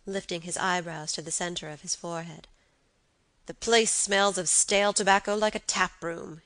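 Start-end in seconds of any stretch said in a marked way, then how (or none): none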